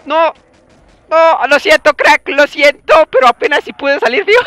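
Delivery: high, loud, rushed sorry; real frantic apology. Real, not acted. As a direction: blurt it while fleeing. Apologizing mid-chaos.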